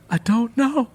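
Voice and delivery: anxious questions rushed out; worried. A narrator's reading.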